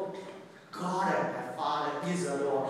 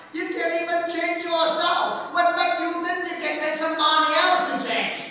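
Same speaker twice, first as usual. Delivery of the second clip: loud, higher-pitched sermon delivery; impassioned preaching; 11 semitones higher, 6 dB louder than usual. Real, not acted. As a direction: preach it out to the whole room. Preaches at full force.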